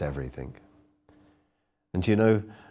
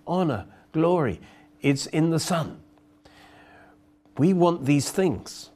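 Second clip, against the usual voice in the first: preaching; 9 semitones higher than usual. Acted. Preaching climax.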